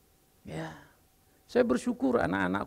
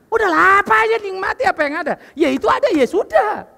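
higher and much louder, fast insistent urging; forceful preaching; 12 semitones higher, 10 dB louder than usual. Acted. Preacher rallying his congregation, loud fast exhortation. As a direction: preach it out, pushing them to be content.

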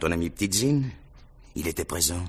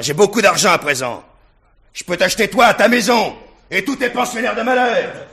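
higher and louder, swelling boast; loud swaggering brag; rising boast; 11 semitones higher, 7 dB louder than usual. Acted. Rogojine boasting of his money.

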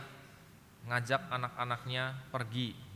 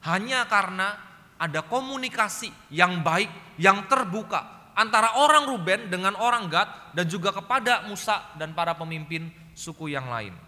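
loud, slow, emphatic sermon delivery; full-force preaching; 8 semitones higher, 10 dB louder than usual. Acted. Preacher urging the congregation, loud and emphatic. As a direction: drive the point home from the pulpit.

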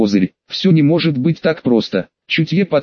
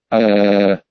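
low furious roar; deep distorted monster roar; 7 semitones lower than usual. Acted. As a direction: roar out in rage, deep and long. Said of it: "он издал яростный рёв".

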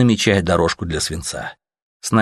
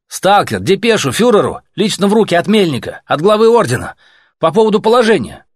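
loud and higher, calling across the distance; 12 semitones higher, 6 dB louder than usual. Acted. Messenger calling to a blinding checkpoint, loud. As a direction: project it to a far-off post.

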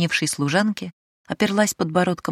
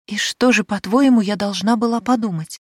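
loud, high, accusing comeback; indignant retort; 5 semitones higher, 5 dB louder than usual. Acted. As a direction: fire the question back, offended.

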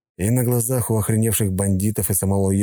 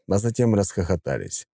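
usual speaking voice

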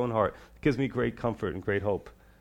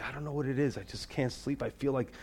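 troubled confession; 6 dB quieter than usual. Retold or imitated. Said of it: "they're in this state and they say".

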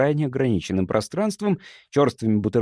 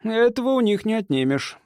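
higher-voiced grudging remark; mild grumble; 10 semitones higher than usual. A narrator's reading. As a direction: grumble it lightly in a raised voice. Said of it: "проворчал он". (лёгкое ворчание высоким голосом)

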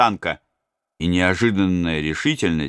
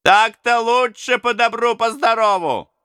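high gloating brigand; gloating robber; 14 semitones higher than usual. Acted. Robbers gloat that he gave in, high and crowing. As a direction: crow it like a pleased robber.

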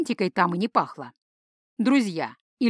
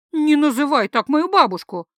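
higher and louder, flaring up at him; 6 semitones higher, 7 dB louder than usual. A narrator's reading. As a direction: snap back defending her grandma. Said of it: "взвилась девушка".

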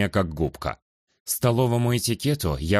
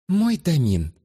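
lightly cooing, sultry; 8 semitones higher, slower than usual. Acted. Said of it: "проворковала Флогия до нельзя томным голосом".

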